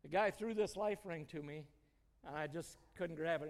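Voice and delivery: ordinary delivery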